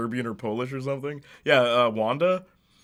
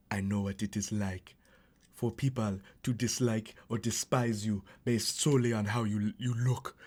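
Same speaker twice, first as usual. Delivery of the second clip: fake Slavic accent; 2 semitones lower, 7 dB quieter than usual. Streamer quoting Wanda in a softer fake Slavic accent. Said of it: "where she's like".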